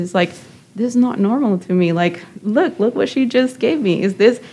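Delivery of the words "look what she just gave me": normal talking voice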